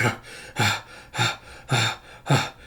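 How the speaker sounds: soft, breath-only gasps; acted panting. Storyteller as the fighter after slicing through the beast, acted panting. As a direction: catch your breath in short puffs.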